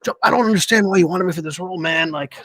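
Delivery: mock bewildered actor; doubtful actor voice. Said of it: "he's like".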